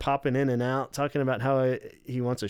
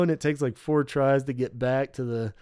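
ordinary talk, nothing marked

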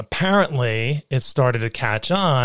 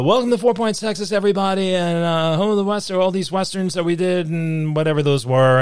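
high, bright mic announcer voice; tour guide announcer; 4 semitones higher than usual. Retold or imitated. Tour guide hyping the tram crowd, announcer voice. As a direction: welcome the tram like a showman on the mic. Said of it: "and I go".

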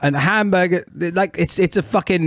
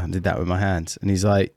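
ordinary delivery

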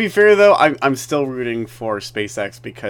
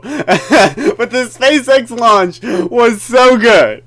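gushing, loud and slow through laughter; laughing excitement; 10 semitones higher, 7 dB louder, much slower than usual. Real, not acted. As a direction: burst out laughing with delight. Gushing about the launch.

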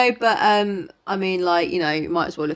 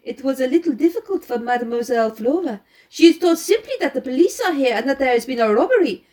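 lightly voiced French-accented detective; Poirot; 5 semitones higher, 4 dB louder than usual. Poirot laying out the girl's movements for his companion, lightly French-accented. Reads Poirot's explanation aloud.

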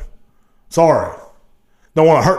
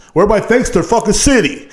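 normal talking voice

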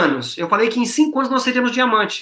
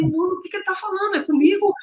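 higher, fast startled retelling; 9 semitones higher, faster than usual. Retold or imitated. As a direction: blurt it out like you just got caught off guard. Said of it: "levantei todo assustado".

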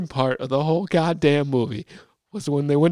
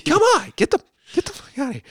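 mocking high damsel voice; hammed-up high-voiced damsel; helpless girl; 11 semitones higher than usual. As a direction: mimic her pleading, pitched way up. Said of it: "and then at other times".

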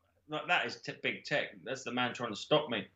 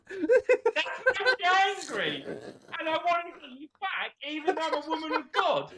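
laughing; 13 semitones higher than usual. Retold or imitated. Nun voiced, laughing.

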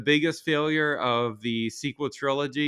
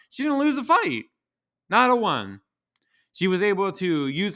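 exasperated high rant; 5 semitones higher than usual. Real, not acted. Ranting about Rey.

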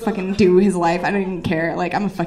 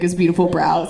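ordinary talk, nothing marked

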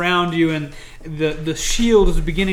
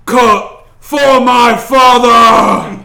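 very slow, much louder, higher war cry; battle cry; 7 semitones higher, 15 dB louder, much slower than usual. A narrator's reading. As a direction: scream it charging the orcs. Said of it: "unsheathes his axe and says".

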